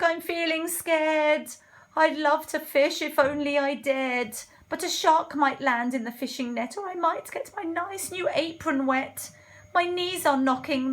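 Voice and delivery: high, loud worried voice; timid cook, scared. Acted. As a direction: play a wimpy cook fretting.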